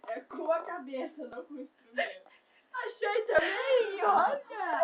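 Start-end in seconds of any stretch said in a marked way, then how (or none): 2.7-4.8 slow, loud happy shout; excited. Real, not acted. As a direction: call out the find. Excited find of a pick.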